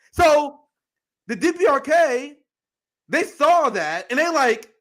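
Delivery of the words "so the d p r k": ordinary delivery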